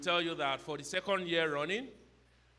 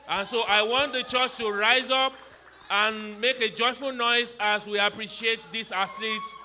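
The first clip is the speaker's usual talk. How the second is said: rallying shout; 4 semitones higher, 6 dB louder than usual. Real, not acted. Rallying church to cheer.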